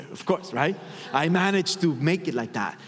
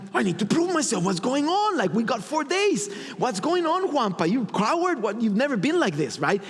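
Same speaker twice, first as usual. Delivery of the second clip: higher, agitated voice of his ego; pushy ego voice; 7 semitones higher than usual. Retold or imitated. His ego scolding him for holding back, higher and agitated. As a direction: needle yourself like a restless ego. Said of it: "My ego wanting to get in the way".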